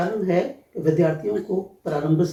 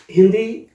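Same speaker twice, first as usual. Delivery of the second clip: Hindi said much louder with a rising tune; clear demo of Hindi; 3 semitones higher, 8 dB louder than usual. Shown as a demonstration. Teacher holds up the word for the interviewer, loud and rising. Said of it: "Hindi का उच्चारण".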